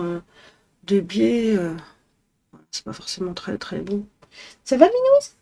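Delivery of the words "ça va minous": high pet voice. Real, not acted. Woman greeting her cat in a very high cooing voice. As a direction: coo it up high like to a kitten.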